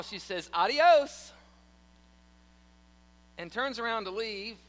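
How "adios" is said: loud, high, smug farewell; cheeky adios. Retold or imitated. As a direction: toss it off with a grin. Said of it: "with a big smile".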